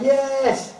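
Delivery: high shouted yes; crowd shout. Retold or imitated. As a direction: yell it like a jeering crowd. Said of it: "They're gonna shout".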